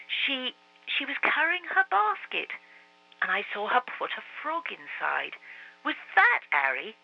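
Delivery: put-on halting young boy; boy's voice. A narrator's reading. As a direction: remember it as a boy. Marcus remembering.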